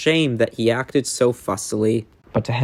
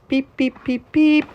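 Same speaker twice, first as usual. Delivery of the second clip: high horn beeps; 10 semitones higher than usual. Acted. Imitated engine horn.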